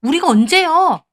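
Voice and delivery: indignant retort. A narrator's reading. Sister-in-law arguing back at the wife, indignant retort. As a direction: fire back indignantly, stretching it out. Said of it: "신우도 막 따지더군요".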